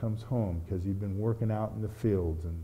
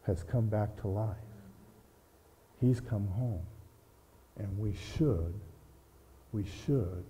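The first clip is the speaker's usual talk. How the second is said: tender father; 3 semitones lower, 6 dB quieter than usual. Acted. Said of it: "And Dad said".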